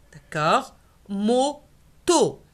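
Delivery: ordinary talk, nothing marked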